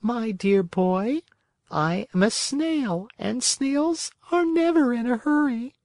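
calm, peaceful. Acted. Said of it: "in a calm, peaceful voice".

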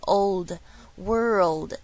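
put-on "old" alone, then whole "world"; slow "old", then drawn-out "world"; syllables built up. Shown as a demonstration. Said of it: "再听到一个old".

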